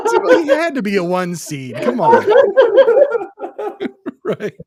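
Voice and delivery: high, loud laughing talk; laughing hard. Real, not acted. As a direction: laugh it out through the words. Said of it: "I knew it".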